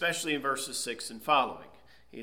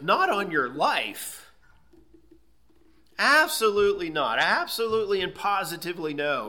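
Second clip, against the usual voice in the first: louder, higher, each no hammered home; emphatic no; 5 semitones higher, 5 dB louder than usual. Retold or imitated. Preacher voicing Paul's refusal, forceful emphatic no. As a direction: voice Paul refusing with full force. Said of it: "he gives a very emphatic no".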